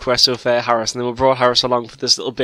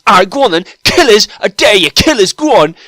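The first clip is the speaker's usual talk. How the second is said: loud, higher-pitched mock dare; imitated defiant shout; 7 semitones higher, 7 dB louder than usual. Friend mimicking the wheelchair character daring killers, loud and defiant. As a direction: goad them at a raised, high pitch.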